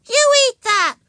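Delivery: put-on high, loud bossy child; shrieked little-kid demand; shouted command. A narrator's reading. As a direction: shout it as a small girl's order. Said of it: "she shouted".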